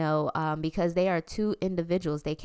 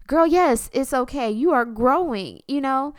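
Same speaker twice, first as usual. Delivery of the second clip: much louder and higher, firmly reassuring; emphatic reassurance; 6 semitones higher, 5 dB louder than usual. Retold or imitated. Mother to worried daughter, loud and emphatic reassurance. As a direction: reassure her loudly, no doubt at all.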